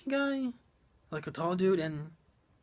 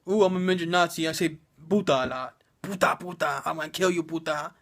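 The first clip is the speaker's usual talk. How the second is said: lightly voiced mock villain, a bit louder; mock Nazi midget; 3 dB louder than usual. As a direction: toss off a cartoon bad guy saying puta. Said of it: "And his whole character is".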